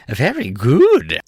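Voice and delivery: slow, pleased and loud; a gloating king, slow and loud; satisfied. A narrator's reading. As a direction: savour it like a win. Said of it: "in a tone of satisfaction".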